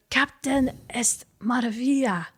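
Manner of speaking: a lightly voiced dazed soldier with a Spanish accent; woozy, a bit slurred, just a hint of Spanish; dizzy soldier. Dazed Spanish soldier naming Monica after the explosion, lightly voiced and woozy. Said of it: "So he's a bit dizzy".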